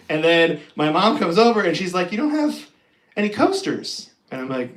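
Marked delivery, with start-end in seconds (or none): 2.1-4.1 higher, light mom voice; a hint of a fussing mother; lightly voiced mom disapproving; imitating mom.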